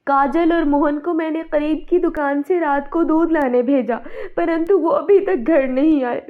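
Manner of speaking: distraught mother's account; tearful distress. Acted. Wife telling husband the children are missing, tearful distress. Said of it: "कामिनी जोर जोर से रो रही".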